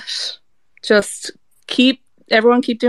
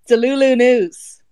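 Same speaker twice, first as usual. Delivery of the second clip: slow, announcer-style segment title; news announcer; 4 semitones higher, slower than usual. Pretend.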